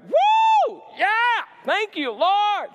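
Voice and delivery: a high, loud whoop of joy, then a slow cry of thanks; joyful whoop. Retold or imitated. Driving home after the date, whooping with joy. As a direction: whoop to the sky, then thank the Lord slowly.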